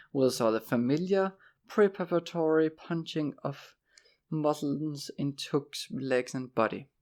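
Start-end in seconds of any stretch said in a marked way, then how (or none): none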